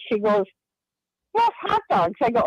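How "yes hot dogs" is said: excited confirmation. Retold or imitated. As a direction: imitate the psychic's high-pitched, delighted yes. Retold psychic confirms the smell.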